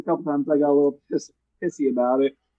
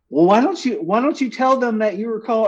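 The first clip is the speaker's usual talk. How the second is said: higher-pitched quoted wife; imitating his wife; 7 semitones higher than usual. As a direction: lightly voice a wife's suggestion, pitched up. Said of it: "'cause my wife said".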